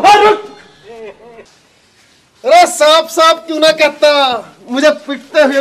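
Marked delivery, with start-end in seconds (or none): 0.0-0.4 high, sharp shout to halt; calling out. Acted. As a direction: stop someone who is walking off. Calls out to man slipping away.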